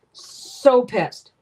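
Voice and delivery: angry outburst. Real, not acted. Venting about lost package.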